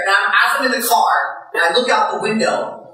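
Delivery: ordinary delivery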